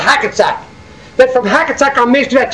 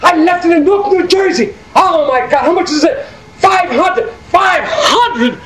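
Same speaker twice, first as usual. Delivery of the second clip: alarmed; 7 semitones higher, slower than usual. Retold or imitated. Realizing the wallet was left.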